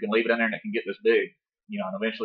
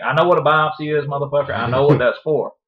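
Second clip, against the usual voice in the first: louder, insistent mock confrontation at the doctor; hammed-up indignation, punching the swear word; retold angry outburst; 4 semitones higher, 6 dB louder than usual. Husband retelling snapping back at the doctor, louder and indignant.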